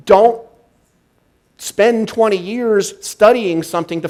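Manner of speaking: rally the crowd to act now; preaching at full force. Acted. Speaker firing up the audience for the new year, in full preaching mode.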